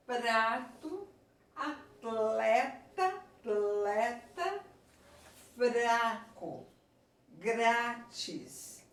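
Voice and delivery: "Prato, atleta, fraco, grátis" stretched, each cluster clearly heard; slow teacher's word list "Prato, atleta, fraco, grátis", one word at a time; both consonants sounded. Shown as a demonstration. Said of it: "as duas são pronunciadas".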